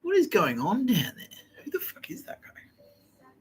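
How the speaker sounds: high, eerie ghostly voice, mostly whispered; Space Ghost voice. Retold or imitated. Ghost sensing a stranger below, high eerie whisper. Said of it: "that was my Space Ghost going".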